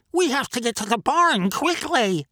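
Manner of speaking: high, urgent duck quack. A narrator's reading. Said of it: "Duck quacked".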